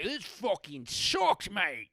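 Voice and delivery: put-on drawn-out, high Peaky Blinders-style British accent. Imagining Shakespeare reviewing Peaky Blinders, hammy British accent. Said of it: "I can do a great British accent".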